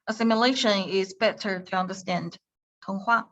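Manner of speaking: normal talking voice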